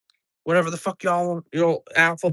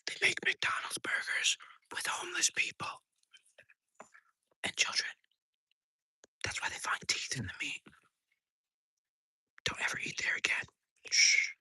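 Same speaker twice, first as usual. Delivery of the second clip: hushed secret-telling whisper; conspiratorial whisper; 11 dB quieter than usual. Pretend.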